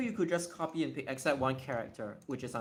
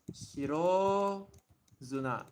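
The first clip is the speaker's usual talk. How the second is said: broken-down demonstration; 2 semitones higher, slower than usual. Shown as a demonstration. Teacher showing how the name flows, split-up pronunciation. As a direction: stretch "Shiro", break, then drop on "Zuna". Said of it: "so it's like".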